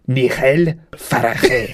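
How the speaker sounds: "Nigel Farage" read as Spanish, nee-HEL fa-ra-HEH; slow Spanish accent with throaty j sounds; Spanish pronunciation. Shown as a demonstration. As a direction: say the name as a Spaniard would read it. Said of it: "That's how it would be in Spanish".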